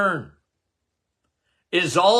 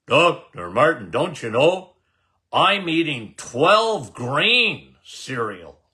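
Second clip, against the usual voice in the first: lower, slow imitation of a know-it-all patient; mock smug patient; 5 semitones lower than usual. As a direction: play the patient who thinks cereal is healthy, slowly.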